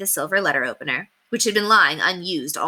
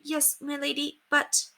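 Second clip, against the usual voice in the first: soft, higher frightened squeak; terrified maid; 8 semitones higher, 7 dB quieter than usual. Acted. Terrified maid repeats the same phrase. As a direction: barely get it out, frozen with fear. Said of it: "Terror had reduced Margaret".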